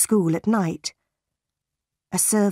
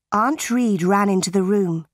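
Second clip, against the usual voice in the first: ordinary delivery, nothing marked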